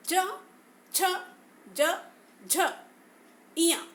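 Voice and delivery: च छ ज झ इया sounded one by one; consonant demo. Shown as a demonstration. Teacher demonstrates the च group to students. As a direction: recite the च group crisply. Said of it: "च वर्ग के उच्चारण करते समय".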